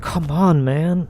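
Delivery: mock a fed-up teammate urging you on; imitated upset partner.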